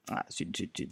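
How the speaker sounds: whispered, annoyed zut; real frustration whispered to yourself; muttered curse. Real, not acted. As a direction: curse under your breath. Annoyed at a login problem.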